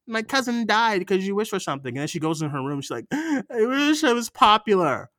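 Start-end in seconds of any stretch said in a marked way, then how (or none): 0.1-1.9 high, whiny imitation of a movie friend; hammed-up scolding friend; mock teen pleading. Repeating the movie line. 3.1-5.1 high, loud, hammed-up movie heroine; mocking teen-drama yearning; mock heartfelt wish.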